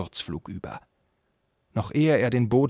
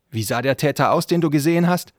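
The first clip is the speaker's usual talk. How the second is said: loud question climbing in pitch; stern interrogation; 6 semitones higher, 7 dB louder than usual. Acted. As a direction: press the suspect hard for details. Prince interrogates the suspect. (streng und laut nachgefragt)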